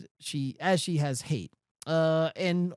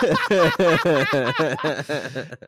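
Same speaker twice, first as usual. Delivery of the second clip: laughing; 9 dB louder than usual. Real, not acted. Co-host laughs at his own joke about her.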